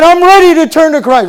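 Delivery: high, loud declaration; really loud. Retold or imitated. Bill answering the pastor at the altar, loud. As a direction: shout it back with conviction. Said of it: "really loud".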